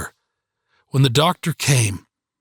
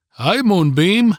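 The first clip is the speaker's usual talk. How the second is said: slow, higher tender greeting; tender father; 8 semitones higher, 4 dB louder, slower than usual. A narrator's reading. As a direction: greet your daughter gently. Father greets injured daughter tenderly.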